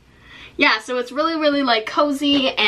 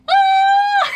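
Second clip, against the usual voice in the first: much higher, loud delighted shriek; excited scream; 5 dB louder than usual. Real, not acted. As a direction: shriek with joy. Showing her parents' gift.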